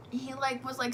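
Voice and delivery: normal talking voice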